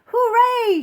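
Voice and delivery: very high, loud cheer; king cheering at the top of the stack; joyful shout. Acted. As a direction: whoop with delight. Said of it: "shouted Yertle".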